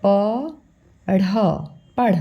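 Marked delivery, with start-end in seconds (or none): none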